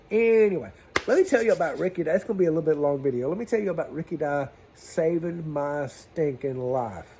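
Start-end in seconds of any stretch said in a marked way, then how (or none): 0.1-2.0 louder and higher announcing. Real, not acted. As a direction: announce the new topic. Loud restart of topic.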